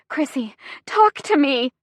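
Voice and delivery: high, pleading urgency; voice cracking. A narrator's reading. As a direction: plead for an answer, voice close to breaking. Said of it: "My voice cracks".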